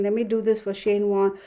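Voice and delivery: ordinary talk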